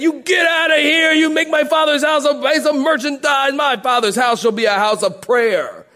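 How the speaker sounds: high, loud commanding shout; righteous shout. Retold or imitated. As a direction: drive the merchants out in holy anger. Jesus drives out the merchants.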